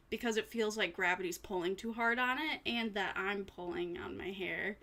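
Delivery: ordinary talk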